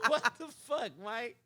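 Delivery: high-pitched, through laughter; laughing disbelief. Retold or imitated. Teammate reacts to Mike lying down, laughing. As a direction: crack up while quoting the disbelief.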